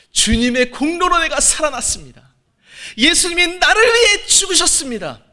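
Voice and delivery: high, loud sermon climax ending in a shout; preaching at full force. Acted. A pastor leading his flock in Peter's confession, shouting. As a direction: rouse the congregation to a confession.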